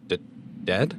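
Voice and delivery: shaky stammer on dead. A narrator's reading. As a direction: stumble over the word in shock. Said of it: "I stammered".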